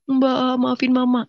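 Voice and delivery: high, pleading little sibling in tears; imitated crying. Crying sibling asking forgiveness for mother, imitated crying. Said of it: "Adik saya yang menangis".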